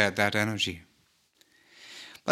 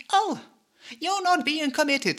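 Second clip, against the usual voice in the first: mocking accuser.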